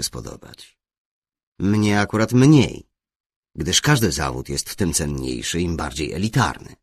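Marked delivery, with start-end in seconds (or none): none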